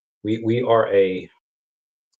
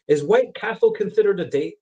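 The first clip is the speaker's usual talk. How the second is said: higher voice for friend Kara; imitating Kara; 9 semitones higher than usual. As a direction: play Kara asking, pitched up.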